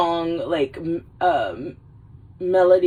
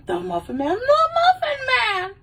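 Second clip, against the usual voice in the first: shocked high cry. Retold or imitated. Farquaad's shocked repeat.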